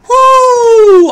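very high, loud whoop; excited whoop. Real, not acted. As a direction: cheer the draw. Big draw success.

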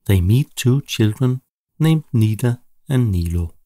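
plain reading voice

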